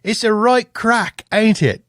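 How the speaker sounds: hammed-up stage Irish, drawn out; terrible fake Irish accent. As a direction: attempt a bad Irish accent. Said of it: "That was terrible".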